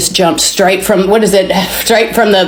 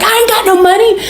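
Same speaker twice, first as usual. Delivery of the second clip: mock screaming; 13 semitones higher than usual. Ranting passenger out of gas, mock screaming. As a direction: imitate a worked-up yell, voice pitched way up.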